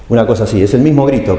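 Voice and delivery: ordinary delivery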